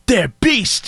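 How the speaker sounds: badly faked British accent, played for laughs; mock British accent. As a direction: parody a failed British accent. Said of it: "Keanu Reeves doing a British accent".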